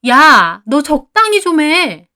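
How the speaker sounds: loud, slow, high-pitched outburst; fed-up yell, each word drawn out; angry shout. A narrator's reading. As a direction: shout at a friend to back off. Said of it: "저는 그 말에 소리를 질렀습니다".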